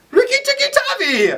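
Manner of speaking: very high, held-out cry; piercingly high comic holler; mock shout. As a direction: call it out like a startling little man. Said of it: "a man shouted from behind him".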